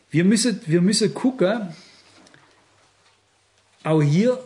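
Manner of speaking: ordinary talk, nothing marked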